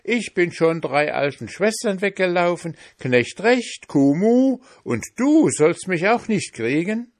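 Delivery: high, boastful pancake voice; cheeky pancake. A narrator's reading. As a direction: taunt them from high up. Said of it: "rief der Pfannkuchen".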